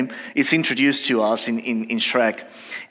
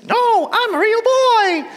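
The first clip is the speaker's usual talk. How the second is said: very high, squeaky little puppet voice; Pinocchio; 4 dB louder, slower than usual. Acted. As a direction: protest in a stretched-out cartoon falsetto. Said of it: "then Pinocchio says".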